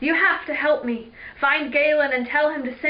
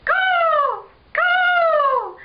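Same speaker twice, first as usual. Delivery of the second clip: very high, loud creature screech; lizard caw; 7 dB louder than usual. Acted. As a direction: screech like a swooping flying lizard. Imitating swooping lizards' screeches.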